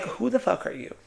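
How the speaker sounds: mock who-are-you disbelief; dismissive reaction. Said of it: "you're like".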